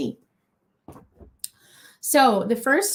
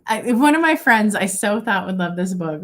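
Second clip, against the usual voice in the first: normal talking voice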